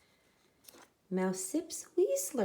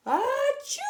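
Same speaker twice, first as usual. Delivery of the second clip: loud, high sneeze; a huge bear sneeze; big sneeze; 7 dB louder than usual. Acted. Pepper makes the sleeping bear sneeze, loud sneeze.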